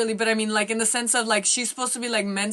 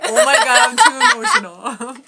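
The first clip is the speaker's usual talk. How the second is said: high, loud teary laugh through the words; real giddy laughing, almost crying; emotional laughing; 8 semitones higher, 10 dB louder than usual. Real, not acted. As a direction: laugh it out, overwhelmed and close to tears. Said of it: "Oh my God, I'm getting teary-eyed".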